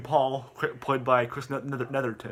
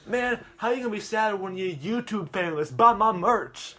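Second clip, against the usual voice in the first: smug YouTuber interview voice, higher; Hogan Paul voice; 7 semitones higher, 3 dB louder than usual. Acted.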